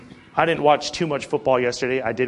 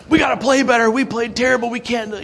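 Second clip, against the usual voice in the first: higher, louder mock yell of a frustrated player; mock angry player; 9 semitones higher, 4 dB louder than usual. Preacher imitates a losing Alabama defender venting at teammates, as a higher, louder mock yell. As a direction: ham up an upset linebacker venting. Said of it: "you imagine he's like".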